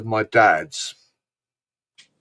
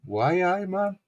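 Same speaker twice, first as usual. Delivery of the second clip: Geordie "Why, aye, man" with a high lift on "man"; Geordie accent; 6 semitones higher, slower than usual. Shown as a demonstration. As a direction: do a cheery Newcastle Geordie. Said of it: "go up to Newcastle".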